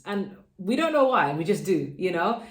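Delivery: ordinary talk, nothing marked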